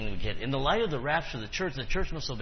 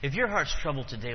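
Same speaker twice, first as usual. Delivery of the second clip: ordinary talk, nothing marked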